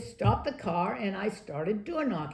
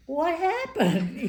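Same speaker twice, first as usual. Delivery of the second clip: puzzled; 8 semitones higher, much slower than usual. Retold or imitated. Puzzled, retelling her own reaction.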